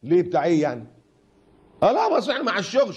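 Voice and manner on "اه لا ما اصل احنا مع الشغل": high, fast whiny excuse; mock excuse. Preacher mimicking a man excusing breakfast with a colleague, high whiny voice. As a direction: squeak out a flimsy excuse.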